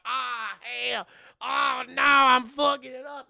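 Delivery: high, loud mock-panic wail; hollering in comic dismay; panicked yelling. Rookie driver slamming into corners and pillars, mock panicked yell. Acted driver wrecking the car.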